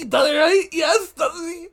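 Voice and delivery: high, loud squealing agreement; excited. Real, not acted. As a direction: squeal in delight.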